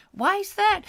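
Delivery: put-on very high shocked old lady; gossiping old lady. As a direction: squeal it, high and prim. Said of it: "I've got to get out of character".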